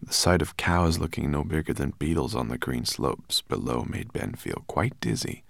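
ordinary delivery, nothing marked

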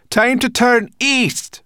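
slow, high, loud call. A narrator's reading. Sorrel telling the dragon to turn, calling out. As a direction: shout directions to the dragon. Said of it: "Sorrel called".

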